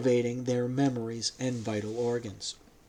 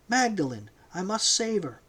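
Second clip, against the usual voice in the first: urgent resolve; 6 semitones higher than usual. A narrator's reading. Man resolves to save woman.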